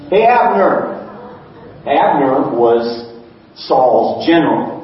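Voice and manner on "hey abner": raised, higher call across the distance. Acted. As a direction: call out to the enemy general from afar. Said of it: "and David yells".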